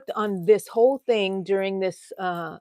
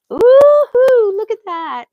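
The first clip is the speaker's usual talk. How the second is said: high, loud happy squeal; delighted squeal; 14 semitones higher, 13 dB louder, slower than usual. Real, not acted. Doll outfit finally fits.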